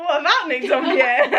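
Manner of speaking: amused, giggling question; laughing. Real, not acted. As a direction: laugh through it.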